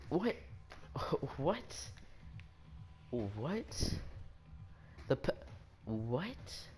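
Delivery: incredulous "what" with a rising end; puzzled, sharply rising question; baffled disbelief. Real, not acted. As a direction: question it as if it makes no sense. Baffled by his own lie.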